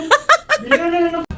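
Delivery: very high giggle. Real, not acted. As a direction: let out a high-pitched laugh. Laughing after remark. (matinis na hagikhik)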